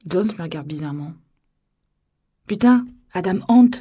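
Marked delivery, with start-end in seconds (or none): 2.5-3.7 much louder, higher exasperated burst; irritated outburst. A narrator's reading. Narrator annoyed Jones doesn't know the singer, exasperated outburst. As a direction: snap it out, annoyed and loud. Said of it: "m'énervai-je".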